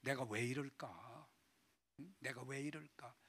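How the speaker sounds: low, very soft self-reproach; remorseful lament. Acted. Peter asking himself after the denial, quiet remorseful lament. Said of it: "대성 통곡했다는 거 아니에요".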